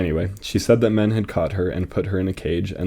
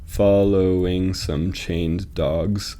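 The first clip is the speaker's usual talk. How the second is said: slow, measured scansion; metrical, deliberate pacing; syllable-counting reading; much slower than usual. Shown as a demonstration. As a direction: draw out each syllable so it can be counted. Testing the line's rhythm.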